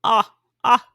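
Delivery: "ah, ah" open and loud, twice; short ah. Shown as a demonstration. Teacher drilling the short O sound, loud open ah. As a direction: punch out "ah, ah" clearly. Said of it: "That short".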